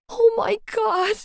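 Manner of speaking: high, slow frightened wail; clinging on, near tears with fright; half sob, half scream. A narrator's reading. Girl climbing onto Johnny, sobbing and screaming. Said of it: "I half sobbed, half screamed".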